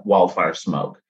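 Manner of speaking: ordinary delivery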